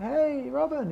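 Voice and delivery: high, sing-out "hey" to a friend; cheery greeting. Retold or imitated. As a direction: call a friendly hello up high. Greeting a friend paddling past.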